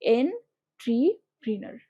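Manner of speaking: "এন্ট্রিপ্রেনার" very slowly, en, tri, prenar; careful pronunciation. Shown as a demonstration. Teacher correcting the pronunciation, carefully broken up. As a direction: put "tri" in place of "tar" in "এন্ট্রিপ্রেনার", syllables apart. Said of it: "উচ্চারণটাকে ঠিক মতো করো".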